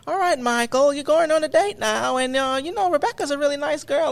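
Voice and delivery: high-pitched motherly impression with Australian lilt; imitated Australian mom. Mike's mom seeing him off on a date, imitated motherly voice. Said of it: "His mom will be like".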